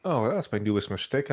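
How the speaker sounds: ordinary delivery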